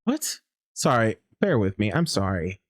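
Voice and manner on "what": sudden high startled what; startled yelp of a question. Real, not acted. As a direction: caught off guard, it jumps up high. Sudden startle at game event.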